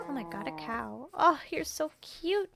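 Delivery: rising delighted squeal at a cow; excited cooing. Real, not acted. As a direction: gush over something cute. Spots a cow in game.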